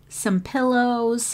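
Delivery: usual speaking voice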